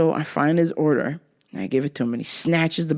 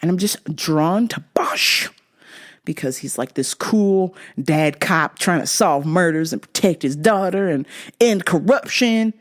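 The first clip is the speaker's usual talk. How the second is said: ordinary talk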